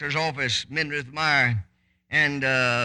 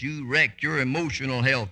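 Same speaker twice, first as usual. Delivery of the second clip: normal talking voice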